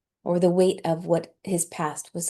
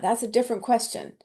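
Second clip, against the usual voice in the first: usual speaking voice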